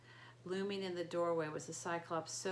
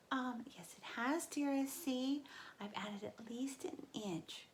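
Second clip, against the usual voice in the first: higher coaxing bride voice, trailing into a slow whisper; Grover in falsetto; 6 semitones higher, slower than usual. A narrator's reading. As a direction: soothe the giant, nervously sweet.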